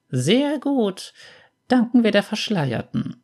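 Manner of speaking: put-on an aunt gently counselling the boy; gentle old aunt.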